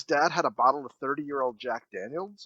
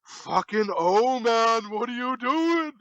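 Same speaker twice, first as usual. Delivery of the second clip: slow, higher mock dad in bathrobe; exasperated dad; 6 semitones higher, 5 dB louder, slower than usual. Dad yelling at a car on the lawn, slow mock exasperation. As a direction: drag it out like a fed-up father. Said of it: "he's like".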